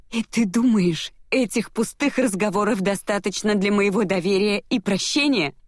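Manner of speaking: high, incredulous question; indignant challenge. A narrator's reading. Heroine scorning his empty talk, indignant challenge.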